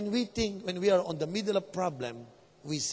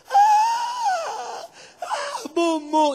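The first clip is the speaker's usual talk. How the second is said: high, loud mock wail of fright; hammed-up panicked scream; terrified crying; 8 dB louder, much slower than usual. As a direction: shriek as if seeing a ghost. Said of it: "Nag-iiyakan na sila".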